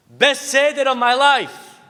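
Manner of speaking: high, loud, drawn-out rave; acted elated mother; mother raving. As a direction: proclaim it slowly, savoring each word. Mother raves about seder to kids.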